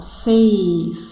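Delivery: "Seis" stretched with a closed e; "Seis", closed-vowel demonstration; closed diphthong. Shown as a demonstration.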